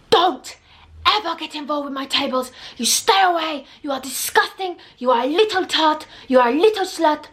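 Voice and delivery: high, angry mock French accent. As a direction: imitate a French waiter telling someone off. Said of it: "I'm trying to do a French accent".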